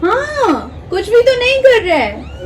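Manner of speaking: high, drawn-out cooing at a kitten; high sing-song pet talk. Real, not acted. Baby-talking to the fussing kitten.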